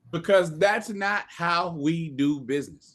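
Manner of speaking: slow, deliberate delivery of a set line; measured, very slow statement of policy; just like that. Shown as a demonstration. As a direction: space out each word firmly. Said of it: "I say it just like that".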